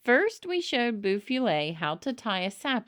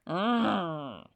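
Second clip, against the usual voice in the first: growl. A narrator's reading. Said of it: "growled my dad".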